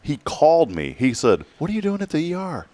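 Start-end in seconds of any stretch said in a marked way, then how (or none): 1.6-2.7 the doctor's voice pitched up, a bit softer; mock-startled friend on the phone; surprised doctor. Quoting Doctor Wynn's call, pitched-up surprise.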